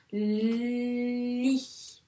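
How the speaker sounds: long level li held while writing; stretched syllable. Shown as a demonstration. Teacher saying li while tracing it, drawn out. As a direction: hold li as long as the stroke. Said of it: "je dis bien tout ce que je suis en train d'écrire".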